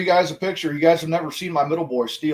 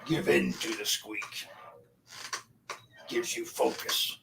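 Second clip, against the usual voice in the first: sinister tempter; 3 semitones lower, 4 dB quieter, slower than usual. Pretend. Tempting voice about the squeak.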